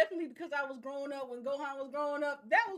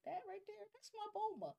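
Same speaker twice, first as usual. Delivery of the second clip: higher and much softer mock possessive god; soft higher mock Beerus; imitating Beerus; 8 semitones higher, 10 dB quieter, faster than usual. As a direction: quietly claim your wife. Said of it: "He was like".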